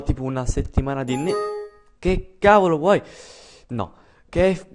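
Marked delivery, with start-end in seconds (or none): 2.0-4.7 loud, high, irritated; annoyed snap. Real, not acted. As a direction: snap at the interruption. Snaps at someone off-camera.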